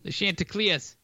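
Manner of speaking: put-on Boston accent on Chanticleers. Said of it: "Chanticleers in a Boston accent".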